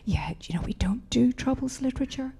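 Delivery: whispered, high and dismissive; soft, breathy brush-off from a publisher. Retold or imitated. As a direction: hush it like a snooty publisher turning you down. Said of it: "And they're like".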